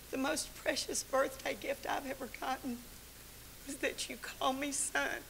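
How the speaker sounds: hushed, breathy whisper of a grateful son; whispered son's words. Retold or imitated. Son whispering thanks to his mom, hushed. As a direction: whisper it like a treasured secret.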